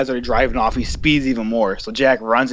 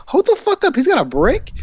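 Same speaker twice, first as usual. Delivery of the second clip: alarmed disbelief; 13 semitones higher than usual. Retold or imitated. Telling friends he spotted a brick, a high shocked squeak. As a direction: voice jumps way up on hold the fuck up.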